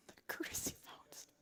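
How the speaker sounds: voiceless, choked disbelief; very faint, incredulous echo; tearful whisper. Retold or imitated. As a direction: squeeze it out, holding back tears. Tearful disbelief at agent's suggestion.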